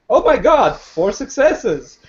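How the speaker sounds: a genuine burst of joy at the result; excited. Real, not acted. Player celebrating a great dice roll, excited. Player rolls four successes.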